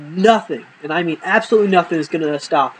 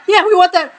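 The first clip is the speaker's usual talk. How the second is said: high mock child voice, all eagerness; eager kids; 13 semitones higher, 4 dB louder than usual. Kids answering the offer of pocket change, high eager voice. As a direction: pipe up like excited little kids.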